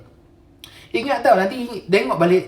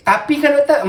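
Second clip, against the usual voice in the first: mock objection, higher pitched; bantahan pesakit; 6 semitones higher, 4 dB louder than usual. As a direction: imitate a patient pleading "but, doctor". Mimics patients who keep objecting. (mengajuk pesakit membantah, nada tinggi)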